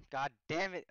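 loud angry curse. Real, not acted. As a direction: swear it out loud.

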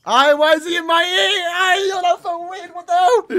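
high, loud startled yelping; freaked out. Real, not acted. Viewer startled by a voice in the ear, freaking out. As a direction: cry out, rattled by a creepy sound.

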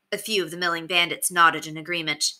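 usual speaking voice

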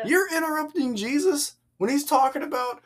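high-pitched, disbelieving outburst; incredulous reaction. Acted. Reacting to a man interrupting Jesus, incredulous. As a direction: act appalled at the interruption.

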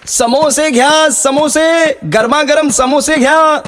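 high, drawn-out hawker's cry; slow, high sing-song street call. Acted. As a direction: sing it out to the whole lane. Hawker calling out samosas.

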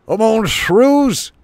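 high, slow barked orders; commanding shrew chief. Acted. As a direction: bellow orders to the crew. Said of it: "Log-a-Log commanded".

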